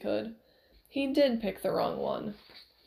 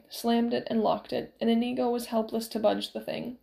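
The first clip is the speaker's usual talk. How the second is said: ordinary delivery, nothing marked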